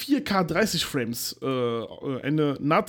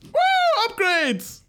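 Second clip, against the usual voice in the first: excited cheer; 13 semitones higher, much slower than usual. Real, not acted. Cheering the upgrades topic.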